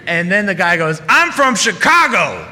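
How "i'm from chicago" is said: slow, high, loud proud declaration; pushy customer. Retold or imitated. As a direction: lay it down like a trump card. Angry customer plays his card.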